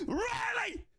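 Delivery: high fan's cry for the scorer; elated shout. Real, not acted. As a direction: cry it out high in sheer joy. Celebrating the scorer.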